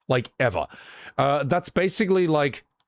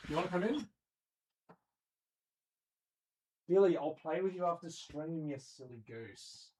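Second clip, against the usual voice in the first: soft, tender pet voice; talking to the cat; 3 semitones higher, 13 dB quieter than usual. Real, not acted. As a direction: coax the cat gently and quietly.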